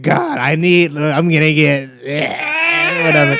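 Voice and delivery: hammed-up obnoxious comedian; obnoxious mock. Mocking a comic's obnoxious onstage voice.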